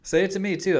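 normal talking voice

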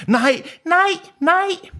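put-on very high, strained child's cry; mock scream. A narrator's reading.

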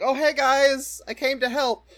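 high, loud eager greeting; Mamoru. Retold or imitated. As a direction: call out like an overkeen helper arriving. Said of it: "Mamoru shows up, and he's like".

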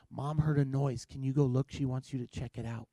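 soft, low night-time murmur; hushed request. Retold or imitated. Dad to sleeping sons about a noise, hushed request. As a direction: quietly pass the job to someone else.

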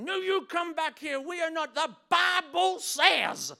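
put-on high, booming American-accented shout. Arguer quoting the Bible at someone leaving, American-accented angry shout. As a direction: thunder it out in a hammed-up American accent. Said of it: "I went to an American accent".

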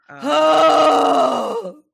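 very loud, high drawn-out wail; loud dismayed wail. Acted. Host reacting to Amy Barrett confirmed by the Senate, wailing loudly. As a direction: cry out long and loud in protest.

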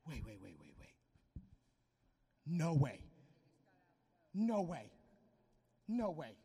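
hushed mock outrage; mock disbelief. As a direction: act shocked and offended. Mock disbelief at Jesus' reply.

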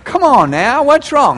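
exasperated coaxing. Acted. Spouse pressing a silent partner, exasperated coaxing. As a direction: plead with them to open up.

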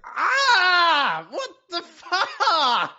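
loud shocked cry, stretched out; startled shout. Real, not acted. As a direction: yelp as if blinded. Suddenly blinded by the light.

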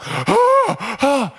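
loud, high winded gasps; gasping for breath. Acted. Preacher acting his run carrying the girl, gasping. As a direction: heave exaggerated runner's breaths. Imitates panting, carrying child in sand.

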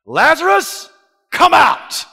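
loud commanding call into the tomb; booming summons. Acted. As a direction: call him out of the grave with authority. Jesus calling Lazarus from tomb.

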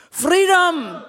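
long, high battle cry, Scottish; Scottish accent. Acted. William Wallace leading the crowd's cry, Scottish-accented shout. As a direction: shout it out to the whole army in Scottish. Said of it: "give my Scottish accent a go".

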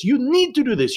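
"need" in a high mock teacher voice; French teacher's voice. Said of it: "and he goes".

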